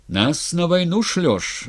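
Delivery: high, slow accusing cry; shouted protest. A narrator's reading. Razin on his knees accusing the tsar, crying out. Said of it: "закричал с колен Разин".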